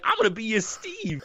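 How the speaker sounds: high, silly character voice; goofy voice. Retold or imitated. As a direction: play it like a dopey man-child. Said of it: "And he's like".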